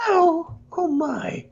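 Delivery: very high, crying falsetto squeal; mock damsel. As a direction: shriek like a fake-helpless diva. Mocking Piggy as damsel.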